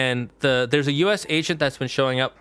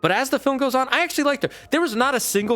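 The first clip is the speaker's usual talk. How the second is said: excited; 11 semitones higher, faster than usual. Real, not acted. Enthusiastic praise of character.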